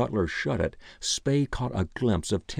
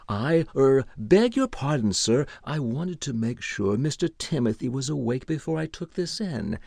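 higher-voiced, apologetic butler; sheepish stammer; 5 semitones higher than usual. A narrator's reading. Butler caught at the keyhole excusing himself, stammering.

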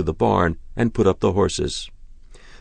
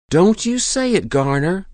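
sharp high warning to her husband; 12 semitones higher, 3 dB louder than usual. A narrator's reading. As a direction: warn him off, voice raised. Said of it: "his wife warned".

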